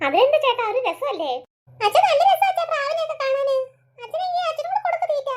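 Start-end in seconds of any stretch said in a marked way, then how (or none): 1.9-5.3 put-on high, giggly child's voice; a delighted small boy coaxing dad; little boy voice. Young son urging his father to feed the pigeons, high giggly child voice.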